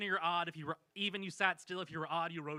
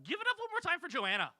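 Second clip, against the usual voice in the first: very high rallying call; high-pitched cheer for a friend; applause call; 11 semitones higher than usual. Real, not acted. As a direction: send it soaring out to the crowd. Calling for audience applause.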